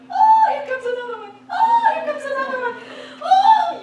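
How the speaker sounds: high, loud, laughing shout; overjoyed cry at the eagles; excited shouting. Retold or imitated. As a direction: yell with teary, laughing delight. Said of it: "You can hear me going".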